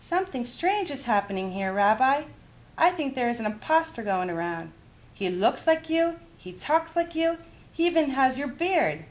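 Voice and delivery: a high, wondering granny voice; puzzled grandmother. Acted. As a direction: puzzle over the impostor aloud. Baba puzzled.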